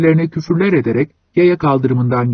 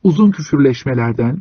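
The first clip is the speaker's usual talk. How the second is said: ordinary delivery, nothing marked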